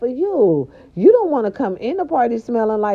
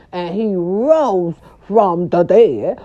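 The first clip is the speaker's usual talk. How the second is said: slow, louder churchy pronouncement; mock preaching; 2 semitones lower, 4 dB louder, much slower than usual. Mocking mom's Easter tradition, slow and preachy.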